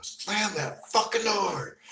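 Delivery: slow, high, angry bark; pissed-off order. Retold or imitated. Fed-up yell at helpers to slam the car door. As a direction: slam the words out at the men fumbling the door. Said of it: "I finally got pissed off".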